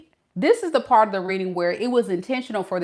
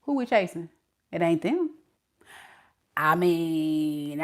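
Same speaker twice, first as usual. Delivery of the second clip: ordinary talk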